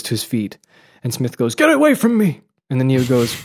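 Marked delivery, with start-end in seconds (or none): 1.6-2.4 high, loud, acted recoil; panicked shout. Smith recoils as Neo rises, panicked shout. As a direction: cry it out high to drive him back.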